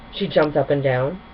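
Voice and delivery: plain reading voice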